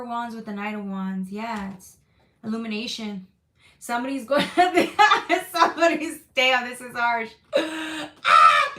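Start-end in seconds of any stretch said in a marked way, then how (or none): 3.8-7.3 higher and louder, laughing through the words. Real, not acted. Laughing at harsh tarot card. 7.6-8.7 high loud laughing cry of "ah"; laughing shriek. Real, not acted. Laughing outburst.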